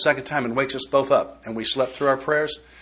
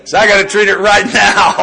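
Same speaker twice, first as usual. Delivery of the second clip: louder and higher, through laughter; emphatic punchline; 7 semitones higher, 10 dB louder than usual. Real, not acted. Telling the meeting about mornings, loud laughing emphasis. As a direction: drive the point home, half laughing.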